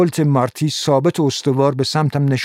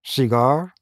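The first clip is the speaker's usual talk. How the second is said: lightly tossed-off question with a rise; offhand cigar offer; casual offer; slower than usual. A narrator's reading. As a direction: offer it like it's nothing. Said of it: "خیلی معمولی به من پیشنهاد داد".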